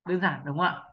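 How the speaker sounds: usual speaking voice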